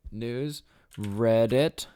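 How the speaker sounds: usual speaking voice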